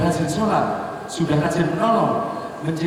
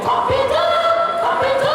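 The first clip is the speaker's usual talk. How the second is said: very high squawking clucks of a hen; clucking hen. Acted. Hen screaming at her hatched chick, shrill clucking. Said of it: "itu jadi ngomong".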